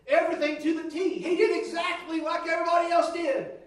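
loud, high retelling, with a laugh; retold shouting. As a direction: belt it out like the preacher who did it all. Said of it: "He said".

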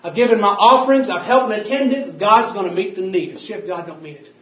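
loud, rising sermon cadence; preaching at full force. Acted. Preacher urging saints to shine the word, preaching at full force. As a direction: hammer each line home to the church.

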